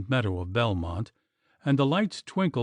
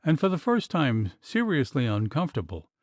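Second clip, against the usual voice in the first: usual speaking voice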